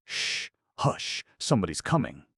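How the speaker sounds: hushed, alarmed warning; urgent hush. Acted. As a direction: warn them, startled.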